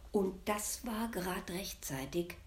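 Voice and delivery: usual speaking voice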